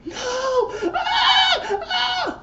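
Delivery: very high shrieking panic, half laughing; panicked scream. Retold or imitated. Cam seeing the blood on her, panicked high scream. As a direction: shriek it out in horror, cracking into a laugh. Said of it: "She's like".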